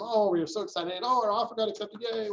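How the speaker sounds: high, bubbly mock glee over the accepted offer; giddy excited client. Buyer celebrating an accepted offer, bubbly mock glee. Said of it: "your client's like".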